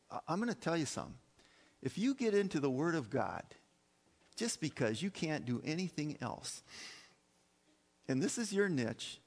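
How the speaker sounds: normal talking voice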